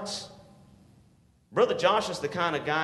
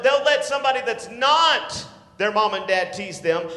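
ordinary talk

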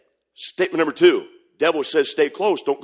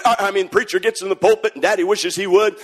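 normal talking voice

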